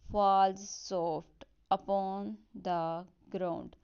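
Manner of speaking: very slow, stressed beats pressed hard; stressed reading. Shown as a demonstration. Teacher to students showing stressed and unstressed beats, slow. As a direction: tap out each stressed beat slowly. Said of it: "stress और unstress label".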